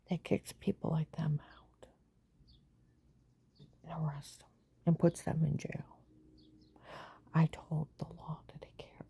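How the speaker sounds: hushed, breathy whisper. Real, not acted. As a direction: whisper it close and secret. Drops into a whisper.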